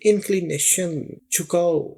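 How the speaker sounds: ordinary talk, nothing marked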